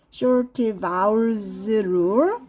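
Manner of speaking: slow teaching read. Shown as a demonstration. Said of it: "One is short vowel sound".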